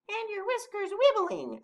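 squeaky-high little animal; Mole's voice. A narrator's reading. Mole adding to the others' questions, tiny high voice. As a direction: pipe up very high. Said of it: "added Mole".

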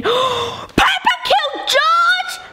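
high, very slow shocked reveal; shocked gasp. Real, not acted. Shocked discovery.

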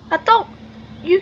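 hiccups. Real, not acted. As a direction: voice jumps up on a hiccup. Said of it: "I have the worst hiccups today".

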